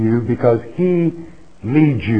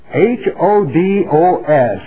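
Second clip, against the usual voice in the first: ordinary talk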